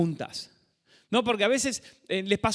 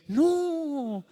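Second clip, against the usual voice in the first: disapproving mechanic; 5 semitones higher, much slower than usual. Acted. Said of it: "lleva al mecánico y te dice".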